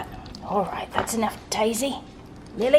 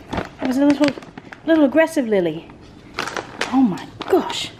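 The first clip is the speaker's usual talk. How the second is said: ordinary talk, nothing marked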